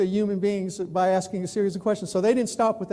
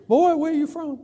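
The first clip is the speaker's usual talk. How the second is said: imitated Texan; 7 semitones higher than usual. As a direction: mimic a nosy Texan, pitched up. Recap of Texan questions.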